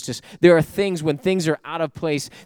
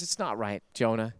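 gentle rebuke; 5 semitones lower, 8 dB quieter than usual. Acted. God gently rebukes Jonah.

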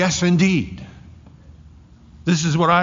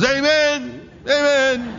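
much higher, ringing amen; eager amen; 11 semitones higher, slower than usual. Acted. John answering Jesus in a high eager voice. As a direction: jump up with a bright eager amen. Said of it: "He goes".

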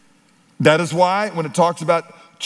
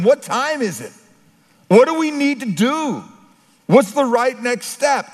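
higher urgent questions; a hint of desperate voices; pleading crowd; 9 semitones higher than usual. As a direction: ask like a lost people begging direction. The world asking urgently.

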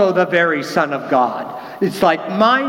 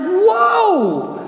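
amazed whoa; 13 semitones higher than usual. Retold or imitated. Nathanael's amazed reaction.

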